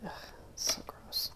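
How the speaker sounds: disgusted little whisper. Real, not acted. Muttering disgust at glue.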